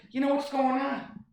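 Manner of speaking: high mock pleading voice; high silly voice. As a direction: ask it in a high put-on voice.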